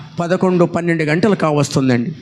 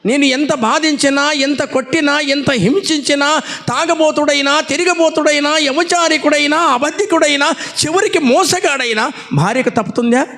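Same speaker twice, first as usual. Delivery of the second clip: higher and rising with each clause, pressing the point; impassioned preaching; 8 semitones higher than usual. Acted. Preacher to his congregation about a loyal wife, impassioned and higher. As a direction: build the list like a preacher driving it home, pitch climbing.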